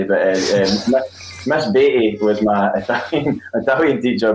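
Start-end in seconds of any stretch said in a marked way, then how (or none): none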